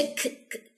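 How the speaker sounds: "کھ" as a soft puff with no vowel; aspirated k sound. Shown as a demonstration. Teacher previewing hams for learners, breathy "کھ" release. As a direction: let air escape after the k in "کھ". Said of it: "کی sound آئے گی".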